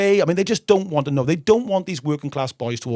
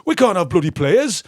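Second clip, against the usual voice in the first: put-on English accent; 3 semitones higher, 4 dB louder than usual. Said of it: "Let me have a go at an English one".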